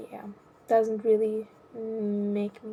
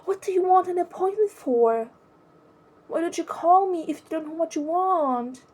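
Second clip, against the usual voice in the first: higher, snappish annoyed retort; a hammed-up annoyed snap, pitched up; mock annoyed receptionist; 7 semitones higher than usual. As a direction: imitate an irritated receptionist brushing off a caller. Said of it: "She's like / annoyed".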